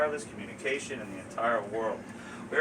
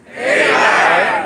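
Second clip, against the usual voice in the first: loud chanted "A buy"; group shout; 4 semitones higher, 16 dB louder than usual. Real, not acted. Audience answers the host on three, loud shout. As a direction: shout it out on the count.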